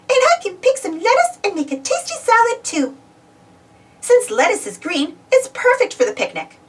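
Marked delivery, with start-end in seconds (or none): 0.1-3.0 high, pleased cartoon mouse voice; delighted high mouse voice; Minnie voice. Minnie finds peas in the garden, high pleased voice. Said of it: "she said".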